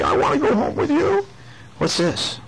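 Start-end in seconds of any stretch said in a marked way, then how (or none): 0.0-1.3 high little pet-shop animal voice; high-pitched cute plea; pleading pet. Acted. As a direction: pipe up high, begging to be bought. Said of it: "That sign says".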